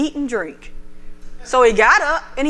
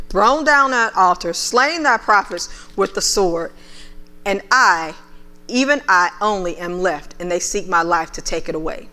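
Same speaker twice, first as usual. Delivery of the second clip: lower, measured Bible reading voice; preacher reading the King James to the church; scripture reading; 5 semitones lower than usual. Acted. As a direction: read the passage with weight. Reads 1 Kings 19 aloud.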